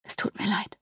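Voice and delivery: very soft, hushed whisper; whispered apology. A narrator's reading. Weeping Sidonia apologising to the kneeling angel, whispered. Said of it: "flüsterte Sidonia".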